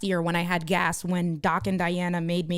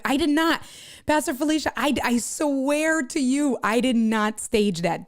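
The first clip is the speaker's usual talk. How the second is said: higher, insistent denial, slowing down; emphatic protest; 5 semitones higher than usual. Real, not acted. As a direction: swear your innocence, stretching the key words. Protesting she did not stage it.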